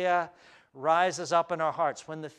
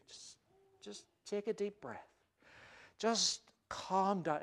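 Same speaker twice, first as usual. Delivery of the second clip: soothing; 3 semitones higher, 9 dB quieter than usual. Acted. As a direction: gently talk them down. Soothing the hysterical people.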